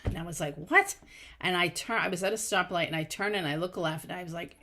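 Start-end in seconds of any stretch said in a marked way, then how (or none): 0.6-1.0 high, loud startled question; startled retold reaction. Driver answers the voice in her head, startled. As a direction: jump up in pitch, taken aback.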